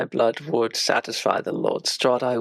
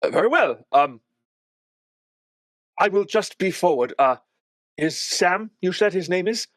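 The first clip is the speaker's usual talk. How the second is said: higher, hesitant questioning; lightly voiced uneasy young woman; Irina, wary; 4 semitones higher than usual. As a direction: probe the strange guest carefully.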